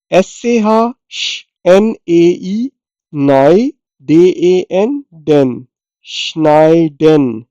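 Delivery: letters, then syllables, then श्नाइडन; spelled out slowly. Shown as a demonstration. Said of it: "श्नाइडन कहते हैं".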